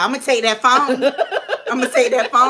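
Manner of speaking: loud, laughing parent threat; mock mom threat. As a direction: play the strict mom, half laughing. Mom threatening to take phone.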